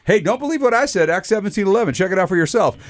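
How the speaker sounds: lightly voiced Bible teacher daring you to check; playful echo of an old teacher's challenge; mentor's catchphrase. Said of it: "He'd always say".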